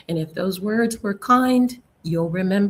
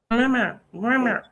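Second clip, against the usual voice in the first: cat meowing mama; 3 semitones higher than usual. Retold or imitated. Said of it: "something like this?".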